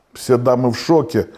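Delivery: ordinary talk, nothing marked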